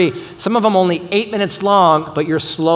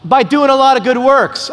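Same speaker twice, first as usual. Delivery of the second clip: imitating son's answer; 8 semitones higher, 4 dB louder than usual. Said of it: "And he goes".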